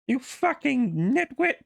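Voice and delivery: high, slow, hammed-up insult; Jordan Peterson impression. Peterson impression insulting an opponent, slow and mock. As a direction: drag the insult out in the put-on voice. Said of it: "I probably sounded nothing like Jordan Peterson".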